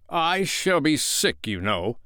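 mild threat, slow and high then dropping; threatening. A narrator's reading. Father warning the children, threateningly. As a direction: stretch out the warning a little. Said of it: "said threateningly".

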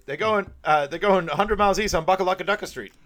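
quoting the film's goofy chase line; mock movie line. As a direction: announce it like a silly cop radio call. Mock news-style movie line.

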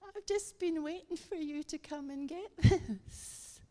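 put-on high, lilting old Highland lady; Highland accent. Old lady handing over the keys, lovely Highland accent. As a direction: imitate an elderly Highland Scot, laugh slipping in at the end. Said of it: "lovely Highland accent".

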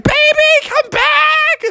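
very high frantic call; high falsetto mother cry; pleading mom frog. Acted. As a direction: shriek after a runaway child. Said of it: "It's like".

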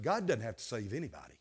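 quick and hushed, dropped low; hushed, rushed confidence; low confiding aside. Real, not acted. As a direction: let them in on a secret, softly. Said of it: "I wanna let you in on something".

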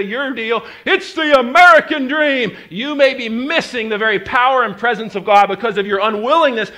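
loud, high impassioned sermon voice; full-throated preacher building fire; preaching at full force. Acted. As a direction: preach it hard to the crowd.

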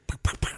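unvoiced popcorn pops; short percussive imitated pops; imitated popping. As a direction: pop the lips like kernels bursting. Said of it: "all of a sudden you hear this".

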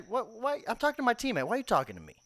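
mock outrage. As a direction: fire it back in disbelief.

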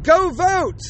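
high, bright-eyed mock cheer; chirpy mock call to vote. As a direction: parody a pop star rallying fans. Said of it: "she's like".

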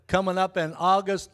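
ordinary talk

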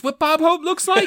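high-pitched retold disbelief; astonished question. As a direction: imitate someone amazed by a celebrity's looks. Acting out her astonished reaction.

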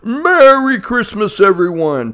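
high, slow Santa greeting. Acted. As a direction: call it out like Santa.

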